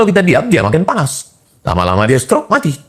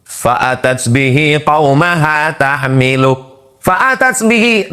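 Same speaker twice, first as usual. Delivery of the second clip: slow, higher chanted Quran verse; Quran recitation; 5 semitones higher, slower than usual. Acted. (tilawah Quran yang lambat dan bernada)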